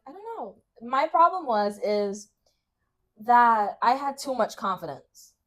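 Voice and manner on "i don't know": coy shy reply. Retold or imitated. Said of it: "I was like".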